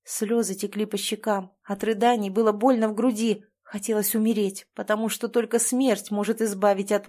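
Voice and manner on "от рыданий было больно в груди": usual speaking voice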